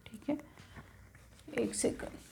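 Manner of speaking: whispered aside. Real, not acted. Aside during lesson.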